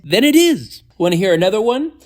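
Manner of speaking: high, loud cheeky quip. Acted. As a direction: toss it off with a grin. Cheeky joking reply.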